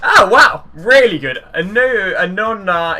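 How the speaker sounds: genuinely floored by the card; amazed. Real, not acted. Great card revealed.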